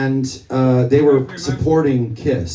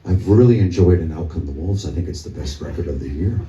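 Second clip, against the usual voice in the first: [name] impression; 9 semitones lower than usual. [name] praising their record backstage, deep and low. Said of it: "and he talks like this".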